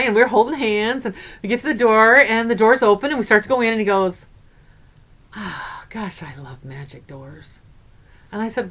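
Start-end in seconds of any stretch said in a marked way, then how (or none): 5.4-7.6 put-on softly, much quieter, in a child's wonder; hushed awe. Grandma retells grandson marveling at the store door, hushed awe. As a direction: breathe it out like a little boy amazed.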